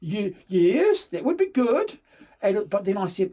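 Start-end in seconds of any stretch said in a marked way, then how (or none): 0.0-2.0 loud, high, tripping over the yes; can barely contain it; stammering keen acceptance; eager yes. Retold or imitated. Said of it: "And I went".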